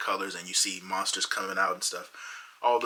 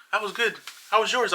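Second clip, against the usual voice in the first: much higher, bright and satisfied; cheery girlfriend; 12 semitones higher, 5 dB louder than usual. Retold or imitated. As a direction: chirp it like nothing happened. Voicing girlfriend after eating.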